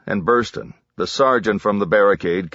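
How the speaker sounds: usual speaking voice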